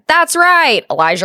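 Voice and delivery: high, loud, upbeat teacher voice; excited teacher praise. Retold or imitated. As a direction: cheer the kid on brightly. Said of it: "And I said".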